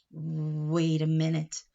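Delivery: drawn-out doubt; slow pause to think. Acted. Uncle to his hat, slow second thought. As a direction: stop and reconsider slowly.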